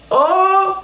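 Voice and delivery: ordinary talk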